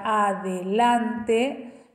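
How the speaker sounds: "adelante" in separate syllables; split into syllables. Shown as a demonstration. Teacher recapping the divided word, syllable by syllable. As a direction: break "adelante" into a, de, lan, te. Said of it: "Una vez que yo dividí la palabra".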